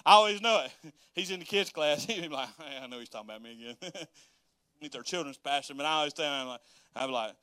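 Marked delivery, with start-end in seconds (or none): 2.6-3.9 low, quick, quiet aside; imitated dad. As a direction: play dad grumbling lightly to himself. Imitating his dad in class.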